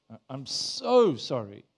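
slow overdone apology, "so" stretched; emphatic groveling apology. Pretend. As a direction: lean long and hard on "so", mock-apologetic. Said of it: "emphasis on the right words".

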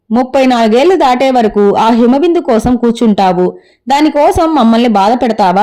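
ordinary delivery, nothing marked

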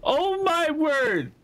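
high, shrill cries of alarm; panicked. Real, not acted. Streamer chased by the squid monster, high panicked cries.

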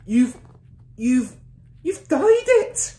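halting start into a long, high shriek; stammer, then shriek it out; mother's shriek. A narrator's reading. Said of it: "shrieked the mother".

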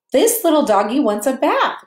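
a hint of an excited child; mild girlish cry; little girl. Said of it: "cried the little girl".

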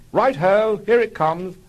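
high, loud, hammed-up chirp; gung-ho and a bit too keen; Algy following orders, excited and loud; eager.

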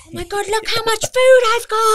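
very high, loud gleeful squeal; hammed-up excitement. Food lover looking at a big plate, hammed-up excitement. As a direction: squeal with over-the-top delight at a huge plateful. Acts joy at seeing her food.

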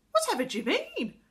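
high, loud baffled question; surprised. A narrator's reading. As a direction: demand an explanation, astonished. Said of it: "looked up in surprise".

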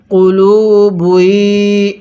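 very slow, sustained vowels; drawn-out teaching chant; model recitation. Shown as a demonstration. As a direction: stretch it for learners to join. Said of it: "পড়ুন".

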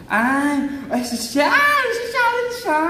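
high, excited squeal of approval; delighted exclamation. Real, not acted. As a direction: squeal over how classy it is. Delighted reaction while unboxing. (tuwang-tuwang tili)

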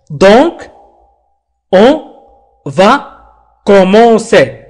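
"Donc, on va commencer" with a long pause after each word; slow, word by word. Shown as a demonstration. Teacher shows learners how to practise, slowly word by word. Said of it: "وتقرؤوه ببطء".

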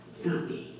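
Peter's denial, whispered in fear; fearful whisper. Retold or imitated. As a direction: breathe it out, scared of being caught. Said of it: "In fear, he says".

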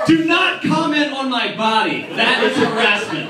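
loud, high indignant telling-off; indignant shout. Acted. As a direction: shout him down with mock outrage. Rehearsed reply to a heckler.

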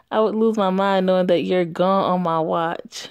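ordinary delivery

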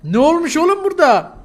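loud, high shout of disbelief; startled, high-pitched yell; alarmed shout. Real, not acted. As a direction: yell it in alarm at your friend. Alarmed reaction to friend's swollen neck.

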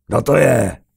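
loud, high challenging shout; startled roar. A narrator's reading. Carlos challenging the unseen voice, loud roar. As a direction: roar it into the dark. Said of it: "Zařval".

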